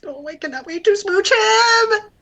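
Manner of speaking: high-pitched, adoring squeal of anticipation; excited cooing. Real, not acted. Friend longing to smooch the cat, excited cooing.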